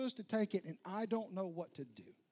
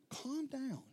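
Calm down raised high and bright; lifted calm down; 8 semitones higher than usual. Retold or imitated. Jesus calming his disciples, higher and brighter. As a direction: call it out higher, to catch them.

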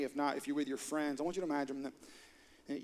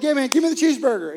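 loud, fast eager order; excited shout; 9 semitones higher, 13 dB louder, faster than usual. Pretend. Customer shouting his order at the waiter, excited. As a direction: blurt it out, pumped for the burger. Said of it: "you're like".

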